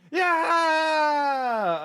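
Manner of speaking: long, loud, high yell; drawn-out heroic shout; triumphant cheer. Acted. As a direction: let out a huge victory cry.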